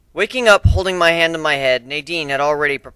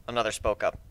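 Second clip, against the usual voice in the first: plain reading voice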